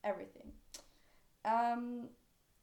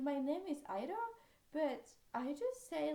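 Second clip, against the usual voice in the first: normal talking voice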